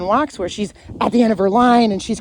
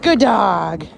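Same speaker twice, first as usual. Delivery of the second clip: louder praise; 4 dB louder than usual. Real, not acted. Trainer praising dog for sitting, louder praise. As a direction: reward the sit. Reward after sit.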